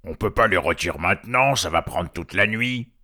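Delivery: grumbling voice posing as troll. Acted. Hidden voice stirring up the trolls, mild grumble. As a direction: object in a put-on troll voice. Unseen voice objects, imitating troll.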